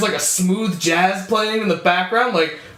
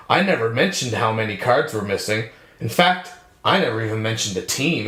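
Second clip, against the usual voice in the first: retold detective; 10 semitones lower than usual. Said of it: "And he's just like".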